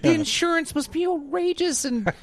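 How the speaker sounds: high, exasperated disbelief; baffled parent ranting; incredulous rant. Retold or imitated. Bewildered rant about Paw Patrol.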